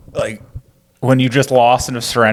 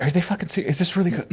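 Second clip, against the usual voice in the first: much softer, higher, half under the breath; mock grumbling aside; 5 semitones higher, 8 dB quieter, much faster than usual. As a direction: grumble so the others won't hear. Officers grumble just out of earshot.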